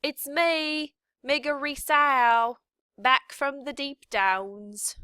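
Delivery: loud, high calling out; shouted. A narrator's reading. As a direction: call out proudly across the kitchen. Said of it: "she shouted".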